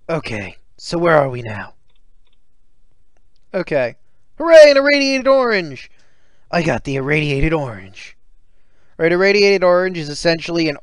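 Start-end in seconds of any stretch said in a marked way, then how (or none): 4.4-5.9 high, loud cheer; mock cheer. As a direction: celebrate the loot.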